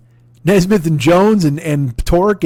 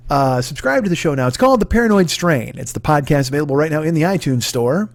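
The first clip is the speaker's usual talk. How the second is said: ordinary delivery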